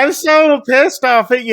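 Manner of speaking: high, loud imitated complaint; mock angry outburst. [name] to friend who drugged her, mock angry.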